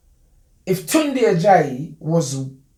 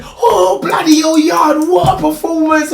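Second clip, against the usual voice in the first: high, shouted delight; much higher and louder, impressed; excited English fan; 11 semitones higher, 8 dB louder than usual. Retold or imitated. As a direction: bellow it like a thrilled ringside fan.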